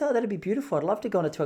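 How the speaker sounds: a hint of a gushing, naive wife; mock-innocent delight, lightly done; imitating wife. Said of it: "I don't know why she spoke like that".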